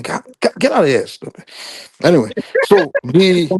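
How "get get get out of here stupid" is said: sharp high scold, starting much higher then settling. Real, not acted. Shooing a kid away mid-call.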